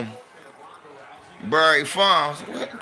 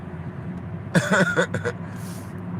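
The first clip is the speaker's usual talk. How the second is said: real chuckle; 2 semitones higher, 6 dB quieter than usual. Real, not acted. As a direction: chuckle softly at your own memory. Laughing at own memory.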